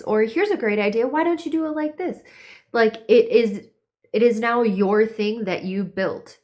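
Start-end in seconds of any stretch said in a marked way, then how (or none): 0.2-2.2 high, quickening mock suggestion; imitated pushy helpfulness; unsolicited adviser. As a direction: mimic an eager meddler pitched up. Voicing pushy outside voices.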